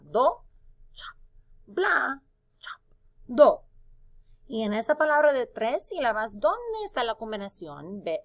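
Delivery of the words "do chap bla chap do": "Do, bla, do" split by quick "chap" chops; syllables cut apart. Shown as a demonstration. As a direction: chop between each beat of "Do, bla, do". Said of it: "Vamos a separar". ("Do, chap, bla, chap, do" cortando cada sílaba)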